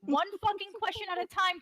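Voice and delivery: yelled in exasperation, pitch leaping high; exasperated shout. Real, not acted. To the rambling debater, an exasperated shout. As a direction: lose patience and bark it out.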